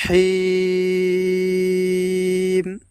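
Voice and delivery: হিম held long and slow in recitation; drawn out syllable. Shown as a demonstration. Teacher isolating হিম for viewers, slow drawn chant. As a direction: stretch হিম slowly, chanting. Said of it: "আওয়াজটাকে এইভাবে লম্বা করে পড়ব".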